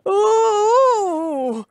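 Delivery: high, loud "ooh" as he lands in the mud; old Tom crying out as he falls; pained cry. A narrator's reading. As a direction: cry out from the fall. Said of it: "cried old Tom".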